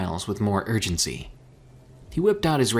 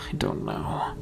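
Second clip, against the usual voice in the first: breathy, barely voiced; whisper; 5 dB quieter than usual. A narrator's reading. Mika whispering in defeat after the failed call. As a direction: trail off into a whisper.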